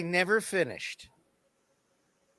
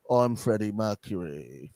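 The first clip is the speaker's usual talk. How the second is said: lower, mocking British put-on voice; 9 semitones lower than usual. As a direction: sneer a lightly faked British accent. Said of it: "put a British accent".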